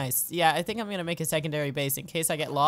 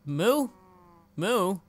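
cow imitation; 2 semitones higher than usual. Calls to cows by imitating them.

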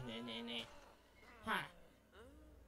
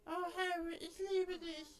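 high mock lovestruck girl; 13 semitones higher than usual. Voicing Ginny's love line.

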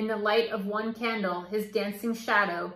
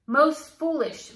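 plain reading voice

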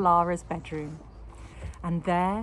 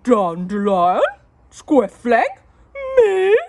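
loud, rising, outraged gasps; gasped protest; 5 semitones higher, 15 dB louder, slower than usual. A narrator's reading. Husband denying it to his wife, loud gasping. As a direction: splutter your innocence, loud. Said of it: "gasped Mister Larrabee".

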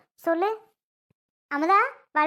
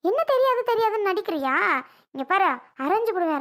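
higher-pitched, threatening telling-off; angry scolding; 4 semitones higher than usual. Acted. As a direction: scold the crying girl into obedience. Mother scolds and threatens crying bride. (கோபமாகத் திட்டும் குரல்)

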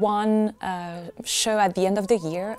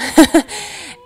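quick breathy laugh, a bit louder; short laugh; 7 semitones higher, 10 dB louder than usual. Real, not acted. Singer amused at calling herself high mezzo, short laugh. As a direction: laugh lightly at yourself.

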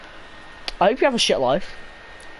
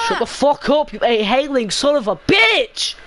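higher-pitched cursing shout; angry yell; 5 semitones higher, 3 dB louder than usual. Real, not acted. Player cursing out another in game chat, angry yell. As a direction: yell it, biggest on the last word.

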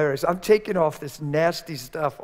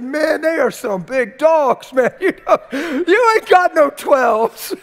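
loud, high, slow, laughing; laughing shout; 11 semitones higher, 10 dB louder, slower than usual. Retold or imitated. Preacher shouting and laughing to Billy about oversized sneakers. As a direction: shout it through a laugh at the huge shoes.

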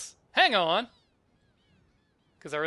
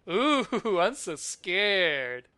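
loud, drawn-out sarcastic fear; mock scared; 5 semitones higher, 9 dB louder, much slower than usual. Kid taunting fleeing villains, mock scared. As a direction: taunt them with exaggerated, slow fake fright.

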